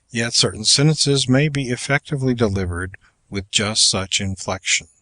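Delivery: usual speaking voice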